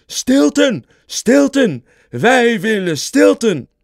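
loud, high mob chant of the name; roaring crowd. A narrator's reading. All the mice roar for Stilton outside, loud chant. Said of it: "Alle muizen brulden".

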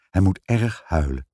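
ordinary delivery

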